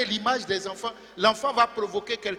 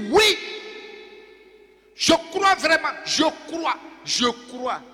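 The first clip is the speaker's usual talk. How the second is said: crying out; 5 semitones higher than usual. Acted. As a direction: call out belief like a desperate father. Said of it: "aussitôt il s'écria".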